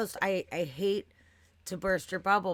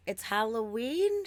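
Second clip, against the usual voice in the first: slow, puzzled, higher "Halloween?"; confused question; 7 semitones higher, 4 dB louder, slower than usual. Retold or imitated. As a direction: mimic the baffled worker's slow question. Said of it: "And she's like".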